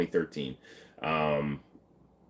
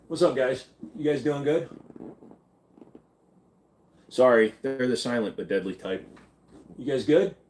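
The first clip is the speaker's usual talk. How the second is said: talking to the guns; 5 semitones higher than usual. Pretend. Greeting the guns on the wall.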